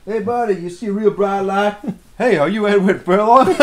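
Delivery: high, loud, dazed hippie voice; spaced-out stoner voice. Acted. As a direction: act out a dazed burnout greeting someone. Voicing a stoner character.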